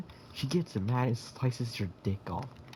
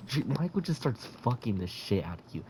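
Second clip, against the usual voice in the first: usual speaking voice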